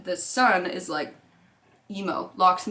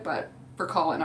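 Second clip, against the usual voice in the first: ordinary talk, nothing marked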